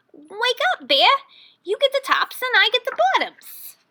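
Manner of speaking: high, loud wake-up call; triumphant rabbit calling out the harvest split; gleeful Hare. A narrator's reading. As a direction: rouse the bear and spring the trick. Hare's voice in story.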